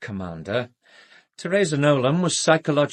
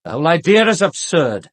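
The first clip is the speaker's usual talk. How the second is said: loud and higher, dismissing it with contempt; scornful outburst; 5 semitones higher, 9 dB louder than usual. Acted. Doctor to the commander, loudly scoffing at the accusation. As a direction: throw the whole idea back at them.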